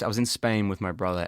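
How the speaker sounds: ordinary delivery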